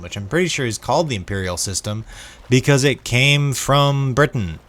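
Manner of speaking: usual speaking voice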